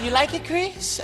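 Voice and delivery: high, soft star impression; gentle, lifted voice with a rising question. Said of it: "He was like".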